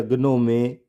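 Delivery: ग्नोमे with hard g and final ay; mispronounced "gnome" as ग्नोमे; wrong, g sounded. Shown as a demonstration. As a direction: say ग्नोमे as g-no-may. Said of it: "इस तरह से नहीं पढ़ेंगे".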